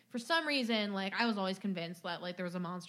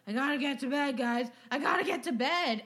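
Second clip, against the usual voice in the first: sleepwalking zombie; 3 semitones higher, 5 dB louder than usual. Retold or imitated. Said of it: "it's like a zombie".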